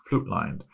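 "flute-lined" said with an oo in place of the a of flat; deliberate mispronunciation. Shown as a demonstration. Joking about own bad pronunciation, deliberately mispronouncing "flute-lined". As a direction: say "flute-lined" with a long oo, as a botched flatlined. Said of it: "I'm surprised I don't say".

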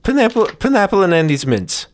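usual speaking voice

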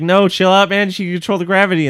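high, loud pleading voice; mock crowd pleading. A podcaster voicing the team pleading, high and loud. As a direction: beg them to calm down, high and loud. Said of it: "everybody's like".

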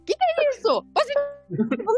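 high, shocked mock alarm; mock panic. Client reading a test result, mock shocked panic. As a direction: squeal the confusion.

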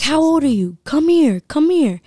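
high, loud mock creep calling her over; imitating a creepy catcaller. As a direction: ham up a pushy stranger shouting come here. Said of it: "He's like".

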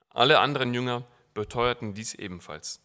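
dramatic scripture reading; Bible reading. Acted. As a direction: read the passage to the congregation with feeling. Reads Mark 14 aloud. (dramatische Bibellesung)

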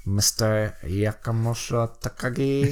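lightly imitated movie villain, measured pauses; Alan Rickman. Villain meeting the group in his monologue, lightly imitated. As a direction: deliver names like a villain's roll call, understated. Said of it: "I did his monologue".

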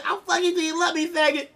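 high, lisping mock Mike Tyson; Tyson impression. Imagining Tyson's threat for friends, high mock voice. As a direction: squeak the threat like a lightweight. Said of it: "could you imagine".

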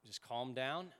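normal talking voice

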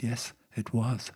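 slow, soft, oddly voiced parrot; a creepy little bird answering back, slow and soft. Parrot answering the burglar in a slow, soft, odd voice.

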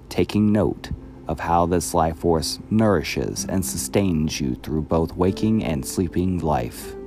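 ordinary delivery

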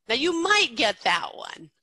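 normal talking voice

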